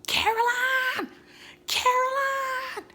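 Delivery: high, drawn-out mock shout; calling out. Calling a name from another movie.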